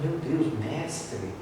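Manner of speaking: softly acted amazement; hushed awe. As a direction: let the words fall away in reverence. Awed man approaching weeping Jesus.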